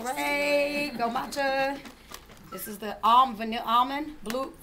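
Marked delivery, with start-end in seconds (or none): 0.0-1.8 loud, slow playful boast; playful cheer. Acted. Teaching matcha, cheering herself on loudly as a TV cook. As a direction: hype yourself up like a TV cook. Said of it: "I'm kinda like Rachael Ray".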